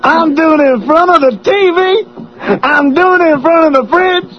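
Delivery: high-pitched stand-up holler; Chris Rock. Acted. Host riffing on the bedroom bit, Chris Rock impression. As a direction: deliver it as a shouting comedian. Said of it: "That's Chris Rock".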